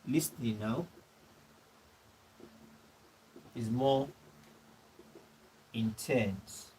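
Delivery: slow, word by word with long pauses; dictating slowly. Real, not acted. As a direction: spell it out for students copying notes. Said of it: "dictating notes to the class".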